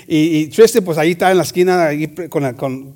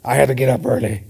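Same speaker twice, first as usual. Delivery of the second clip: low, put-upon sighing complaint; mopey Tristan; 5 semitones lower than usual. Retold or imitated. Teasing the sleepy coworker, low weary whine. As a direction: play the tired friend, mildly. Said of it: "looking at me with his eyes".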